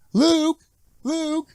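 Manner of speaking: very high, calling out to Luke; Aunt Beru voice. Retold or imitated. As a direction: pitch way up and call his name. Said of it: "My Aunt Beru impression".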